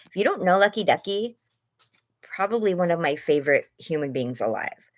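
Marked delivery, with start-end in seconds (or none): none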